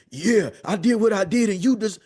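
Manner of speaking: a defensive dad shutting down his son's hurt; dismissive self-defence. Retold or imitated. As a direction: shrug off the blame, loud and high. Rejected defensive reply to son.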